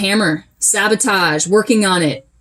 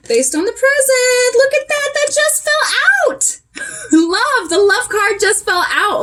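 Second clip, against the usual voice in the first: much higher, delighted surprise as a card drops out; surprised delight; 13 semitones higher than usual. Real, not acted. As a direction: gasp at the card that just fell, with a laugh. Card falls out during reading.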